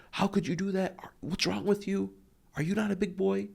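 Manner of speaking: high-pitched scolding yell, far above normal; exasperated dad's high shout; angry parent yelling. Retold or imitated. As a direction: yell in outrage at your child. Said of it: "yelled at him for every time".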